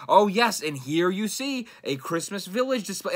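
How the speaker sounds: high, loud, hammed-up presenter voice; stupid tour guide voice. Mocking his old vlog narration, silly guide voice. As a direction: narrate like a cheesy show host, mocking yourself. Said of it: "I would do the stupid fucking voice".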